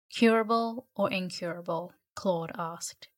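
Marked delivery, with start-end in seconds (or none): 0.1-1.9 lightly snide joke, no real laugh; mildly mocking question; snickering question. A narrator's reading. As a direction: ask it with a hint of a snicker. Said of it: "with a snicker".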